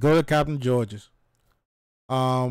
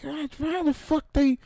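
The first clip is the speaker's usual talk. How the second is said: high, incredulous outburst; can't believe the crab legs came like that; squeaky stunned exclamation; shocked disbelief; 13 semitones higher than usual. Retold or imitated. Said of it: "And I'm like".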